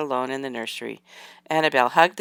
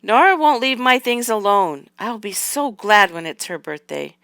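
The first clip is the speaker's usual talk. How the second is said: higher, louder whine of grievance, easing off; loud complaint; 4 semitones higher, 4 dB louder than usual. A narrator's reading. Girl complaining loudly to her grandma about Nora. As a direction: complain loudly, starting high and coming down. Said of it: "she complained loudly to Grandma Catherine".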